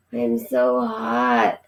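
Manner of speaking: slow, sleepy feverish mumble; mumbled. Acted. Sick boy mumbling to his mother, slow and feverish. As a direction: drag the words out like a sick child. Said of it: "mumbled Keith".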